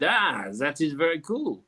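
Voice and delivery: put-on slow, drawn-out French accent. Quiz host acting out the balloon inventor, French accent. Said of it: "I'm gonna do a French accent".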